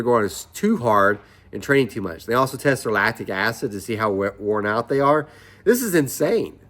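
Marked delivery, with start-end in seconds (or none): 5.6-6.6 amazed. Real, not acted. Amazed at the training.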